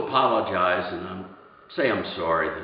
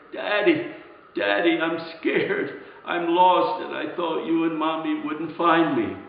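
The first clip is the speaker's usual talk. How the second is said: higher child's voice, lightly imitated sobbing; crying little boy; 6 semitones higher than usual. Lost boy to his daddy, imitated tearful higher voice. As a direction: plead like a scared lost child, without real tears. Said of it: "he was crying and sobbing. He was saying".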